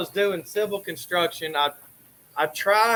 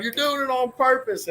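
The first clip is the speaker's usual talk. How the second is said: higher accusing whine; accusing big brother; 7 semitones higher than usual. Retold or imitated. Brother accusing him at night, mock whine. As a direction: mimic the brother's blame.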